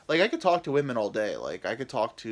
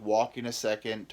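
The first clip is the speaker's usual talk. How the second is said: ordinary talk, nothing marked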